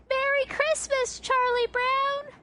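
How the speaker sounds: very high, drawn-out cheer; imitated group yell. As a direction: call it out like cartoon kids yelling in chorus. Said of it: "they yell the very classic line".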